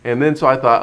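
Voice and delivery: usual speaking voice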